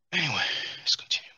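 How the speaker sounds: quick unvoiced whisper. Real, not acted. Aside whisper to self.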